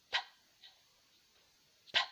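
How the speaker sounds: bare puff of P in "Pa", almost no vowel; isolated P sound. Shown as a demonstration. Teacher showing the sound we make, isolated P in "Pa". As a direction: pop the consonant of "Pa" alone, twice with a pause. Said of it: "le son qu'on fait comme ça".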